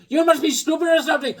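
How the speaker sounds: high, loud taunt; Bobby voice. Retold or imitated. Mimicking Bobby taunting him at the pump. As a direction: jeer it up high. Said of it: "he goes".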